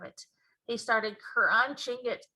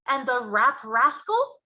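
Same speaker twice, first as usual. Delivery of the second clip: big friendly giant, louder and slower; BFG giant voice; 4 semitones higher, 7 dB louder, slower than usual. Acted. Giant explaining to Sophie, loud and slow. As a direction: boom out the giant's mangled grammar.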